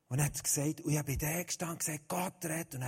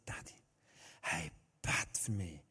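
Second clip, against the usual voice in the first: much softer, slow, small request; soft plea; 9 semitones lower, 8 dB quieter than usual. Retold or imitated. Daughter quietly asks her father to pray for her. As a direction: ask gently like a tired child.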